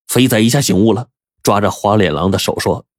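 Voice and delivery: plain reading voice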